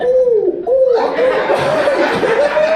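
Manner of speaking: gleeful squeal of triumph; mock excited squeal. Comic squeals back at the heckler, gleeful and loud.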